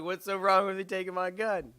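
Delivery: high, loud challenging question; mock indignation. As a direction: act out a put-upon protest. Acted challenge to friend.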